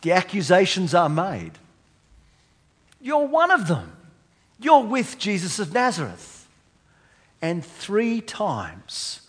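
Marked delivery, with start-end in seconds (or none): 3.0-6.5 accusing. Retold or imitated. Crowd accuses Peter, retold.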